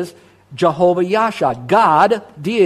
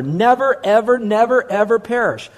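"Never, ever, never, ever perish" hammered higher, each never and ever punched; emphatic never; 4 semitones higher than usual. Shown as a demonstration. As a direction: preach the never ever, hitting every word. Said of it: "underline the word never".

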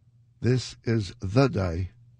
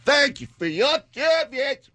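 mock thanks; 5 dB louder than usual.

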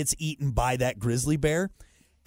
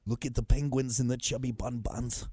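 Herzog; 4 semitones lower, 5 dB quieter than usual. Retold or imitated. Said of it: "so Werner Herzog".

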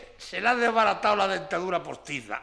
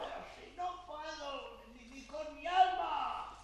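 distant; 6 semitones higher, 12 dB quieter, slower than usual. Acted. Exhausted answer from below.

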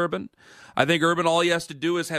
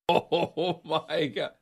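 laughing; 4 dB quieter, much slower than usual. Real, not acted. Schooner tips over on air.

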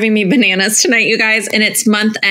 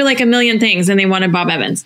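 normal talking voice

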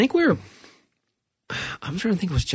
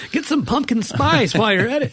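laughing joke; 11 semitones higher than usual. Real, not acted. Joking escalation, laughing.

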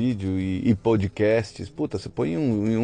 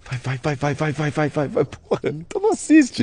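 higher, mock-impatient repeated urging; impaciência encenada; 6 semitones higher, 4 dB louder than usual. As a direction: hurry it along, then burst out higher and louder. Mock hurrying a sped-up show. (apressando com impaciência fingida, cada vez mais agudo)

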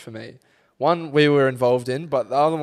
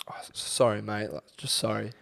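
hushed, sheepish apology; sheepish whisper; 4 semitones lower, 9 dB quieter than usual. Retold or imitated. Apologetic stranger whispering sorry to the lads at the bar. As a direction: whisper it like you're scared of a fight. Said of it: "and goes".

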